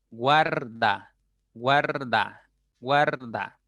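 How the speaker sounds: "guarda" in two stretched syllables, guar-da; slow pronunciation demo. Shown as a demonstration. Teacher drilling the U-A diphthong, "guarda" syllable by syllable. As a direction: draw out each "guarda", falling at the end. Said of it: "cuando pronuncias la A".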